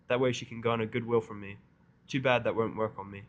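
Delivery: plain reading voice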